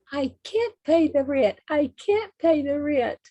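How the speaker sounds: high, loud helpless wail; damsel in distress. Pretend. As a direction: plead like a melodrama heroine. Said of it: "let's see if I can remember it".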